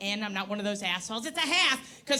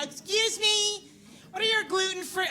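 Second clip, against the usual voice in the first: vegan voice; 7 semitones higher than usual. Retold or imitated. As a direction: ask sweetly like a precious vegan. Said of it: "I gotta put on that vegan bitch's voice".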